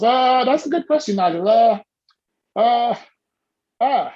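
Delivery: long hesitant uhs between words; mock statesman pausing; Obama pause. Shown as a demonstration. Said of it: "You just use some Obama pause".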